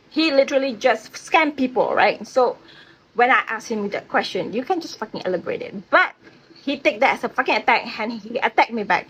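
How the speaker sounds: usual speaking voice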